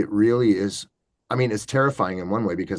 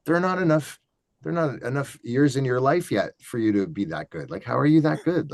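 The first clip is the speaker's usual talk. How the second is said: higher, incredulous protest; retold disbelief; 5 semitones higher than usual. Watching some kid rip claw hammer banjo, incredulous. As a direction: marvel in disbelief at a young talent. Acting disbelief at young player.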